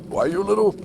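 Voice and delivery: Homer Simpson. Retold or imitated. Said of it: "I'm imagining Homer Simpson choking Bart".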